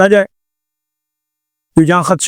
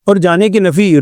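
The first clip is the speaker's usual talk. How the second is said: normal talking voice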